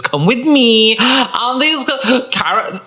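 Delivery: hammed-up vlogger intro; mocking influencers at the market. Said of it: "in come the influencers".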